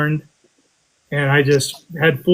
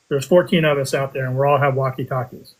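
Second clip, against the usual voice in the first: normal talking voice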